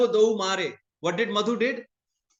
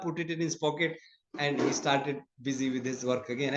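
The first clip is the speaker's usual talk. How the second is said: ordinary delivery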